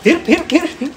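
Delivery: high squeaky repeated teasing. Real, not acted. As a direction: chirp it at the bird. Teasing the bird. (ऊँची चिढ़ाती आवाज़)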